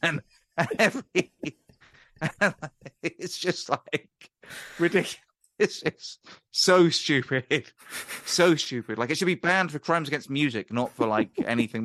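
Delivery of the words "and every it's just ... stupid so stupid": laughing. Real, not acted. Laughing at the bad song.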